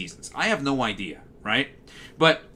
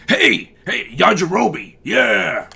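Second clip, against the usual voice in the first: high, loud surfer-dude shout; Yajirobe dub voice; 5 semitones higher, 6 dB louder, slower than usual. Retold or imitated. A streamer doing a cartoon surfer's dub voice, loud and high. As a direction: yell it like a goofy surfer guy. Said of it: "oh, Yajirobe's dub voice".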